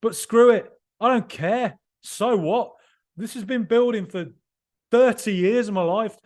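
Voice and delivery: emotional outburst. Real, not acted. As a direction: let it all out, no apologies. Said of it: "I'm sorry if I sound like I'm being emotional".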